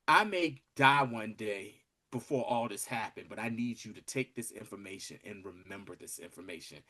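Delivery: low voice sinking very soft; hushed confiding. Retold or imitated. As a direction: imitate someone quietly passing on a secret. Acts professor confiding before his death.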